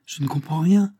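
étonnement léger, montée sur « rien »; un Max perplexe qui ne suit plus; étonné. Acted. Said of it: "s'étonne Max". (Max étonné, un peu plus aigu sur « rien »)

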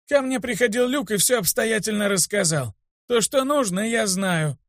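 высокий стариковский голос; коротко откажи голосом старика. A narrator's reading. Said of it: "ответил старик". (высокий голос старого учителя)

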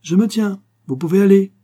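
loud cry up the trapdoor; calling out. A narrator's reading. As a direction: call up from the bottom of the ladder. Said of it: "et cria".

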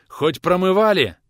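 loud, high, drawn-out demand; demanding question. Acted. Visitor questions the women about the wound, loud demand. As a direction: press them with a slow, loud question.

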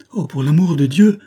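high, loud pleading cry; anguished cry. Acted. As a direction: cry out begging for an answer. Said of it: "m'écriai-je".

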